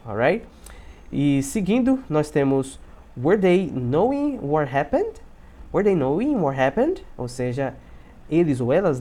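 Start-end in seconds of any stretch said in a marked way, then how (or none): 3.2-5.2 slow, separated words rising on the end; slow model sentence. Shown as a demonstration. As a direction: model the question slowly for learners. Teacher models slowly, then faster. (pergunta modelo, devagar, subindo no final)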